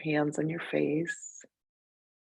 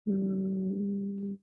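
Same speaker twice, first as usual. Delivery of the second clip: soft, higher sustained hum; higher-pitched hum; 4 semitones higher, 5 dB quieter than usual. Shown as a demonstration. Teacher showing pitch can vary, soft higher steady hum. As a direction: hum softly on one raised note. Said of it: "might be a higher or lower pitch".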